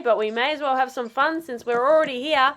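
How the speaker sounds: normal talking voice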